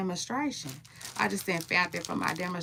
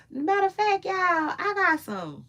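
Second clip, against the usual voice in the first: 1 excited reveal; 12 semitones higher than usual. Real, not acted.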